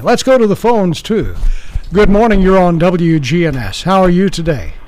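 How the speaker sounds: normal talking voice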